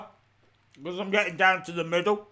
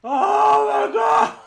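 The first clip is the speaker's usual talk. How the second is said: loud, slow, high wail; stretched-out shout of real pain; agonised cry; 11 semitones higher, 6 dB louder, much slower than usual. Real, not acted. Agony from sour sweets.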